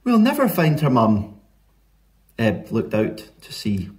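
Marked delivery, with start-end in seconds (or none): 0.0-1.4 higher, mildly despairing voice; a touch of worried Flo; lightly dismayed Flo. A narrator's reading. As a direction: lament it with lifted pitch. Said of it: "said Flo".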